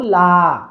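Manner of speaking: লা with the vowel held long; slow, drawn-out লা; long madd. Shown as a demonstration. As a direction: stretch লা out evenly. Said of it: "আলিফ মাদের মতো বলবো".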